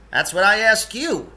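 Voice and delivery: high, loud character voice for the guru; Mad Guru voice. Acted. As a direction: pitch him up as the odd guru.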